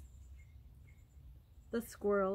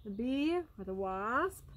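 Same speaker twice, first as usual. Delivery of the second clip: plain reading voice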